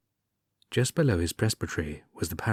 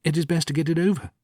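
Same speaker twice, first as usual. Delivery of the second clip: resigned hurry; 8 semitones higher, 3 dB louder, faster than usual. Acted. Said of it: "he said".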